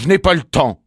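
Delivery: loud, high, impatient refusal; curt angry dismissal. A narrator's reading. Bumblebee slamming the door on the bee, loud and cross. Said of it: "ajouta-t-il en claquant la porte".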